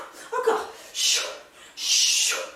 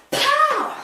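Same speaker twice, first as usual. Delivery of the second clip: punch shout; 12 semitones higher, 7 dB louder than usual. Real, not acted. Punch sound mid-workout.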